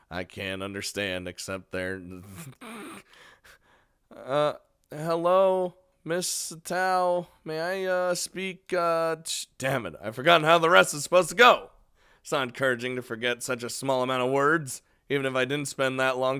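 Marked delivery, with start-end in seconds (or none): 4.2-9.5 higher, hesitant recited greeting; halting phone greeting. Acted. Fumbling a memorized foreign-language phone greeting. 9.6-11.7 loud, fast, higher burst of frustration; exasperated outburst. Acted. Forgets the rehearsed phrase mid-call.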